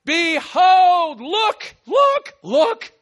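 high, loud call; calling out, look, look; loud behold. Shown as a demonstration. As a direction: shout behold and point.

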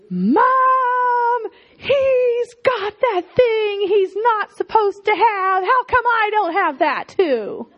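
high, slow, crying complaint to Mom; mock tearful child tattling; whiny child. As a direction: whine like a jealous kid.

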